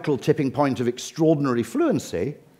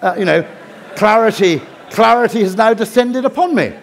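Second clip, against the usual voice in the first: loud, higher mock French dawning realisation; a hammed-up French voice, louder and higher; mock French waiter; 4 semitones higher, 5 dB louder than usual. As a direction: ham up a Frenchman suddenly getting it. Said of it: "they go".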